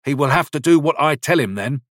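self-important. A narrator's reading. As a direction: puff up with importance. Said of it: "frowned with an air of importance".

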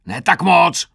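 high, very loud correction; roared. A narrator's reading. Sergeant to recruit standing like a ballerina, exasperated roar. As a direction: roar in exasperation at the overdone pose. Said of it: "zařval Krpata".

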